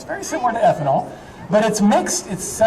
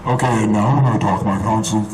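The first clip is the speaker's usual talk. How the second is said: booming low voice from SF6; sulfur hexafluoride voice; 6 semitones lower than usual. Real, not acted. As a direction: talk through a lungful of heavy gas. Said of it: "my voice sounds a little bit different".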